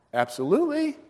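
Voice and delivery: very high-pitched eager reply; acted-out delighted yes; eager acceptance. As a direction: jump at the invitation, pitch soaring.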